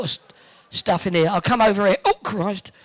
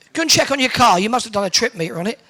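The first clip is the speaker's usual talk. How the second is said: normal talking voice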